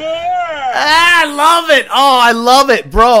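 loud, high, drawn-out approval; gleeful delight. Real, not acted. Host reacting to trooper's rant, gleeful. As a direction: crow with real delight.

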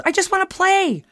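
high, loud pleading complaint; frustrated yell. Retold or imitated. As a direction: whine it out loudly. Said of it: "I yelled".

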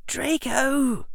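plain reading voice

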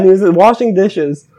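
normal talking voice

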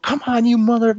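high-pitched reenacted fighting yell; retold yelling. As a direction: yell it as a challenge, retelling the fight. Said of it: "I'm in a closet yelling".

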